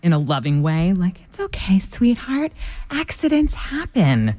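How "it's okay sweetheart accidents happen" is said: calm mom voice. Retold or imitated. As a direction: soothe a child who broke something. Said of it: "I love your calm mom voice".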